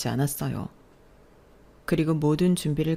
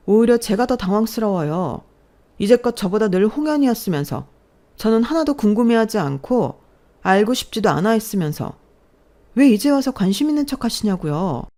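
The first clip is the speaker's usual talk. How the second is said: loud, higher resentful outburst; angry yelling; 7 semitones higher, 6 dB louder than usual. Acted. Daughter shouting back at her parents about favoritism, angry yelling. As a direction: pour out years of hurt, shouting. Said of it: "버럭버럭 소리를 질러".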